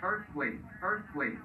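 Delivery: usual speaking voice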